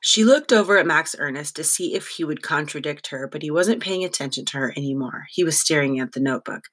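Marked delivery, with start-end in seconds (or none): none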